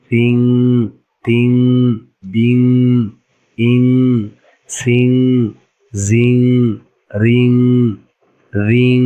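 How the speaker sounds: syllables like "পিং" with the closing nasal sustained; ghunnah drill. Shown as a demonstration. Teacher reading out the chart for learners, ghunnah held on "সিং". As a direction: let each ending, as in "রিং", hum on through the nose. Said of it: "গুণা করতে হবে".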